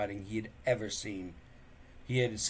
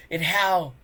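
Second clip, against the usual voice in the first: sneering; 6 semitones higher, 9 dB louder than usual. Acted. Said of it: "sneered Snotlout".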